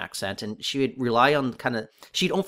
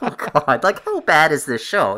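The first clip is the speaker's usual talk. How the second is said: mock dismay at bad writing; groaning disbelief, pitched up and louder; exasperated dismay; 4 semitones higher, 7 dB louder than usual. Podcaster reacting to a hacky show, exasperated.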